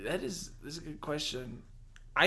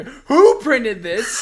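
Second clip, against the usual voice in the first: outraged yell; 9 semitones higher, 15 dB louder, much slower than usual. Pretend. Acted outrage at the sign.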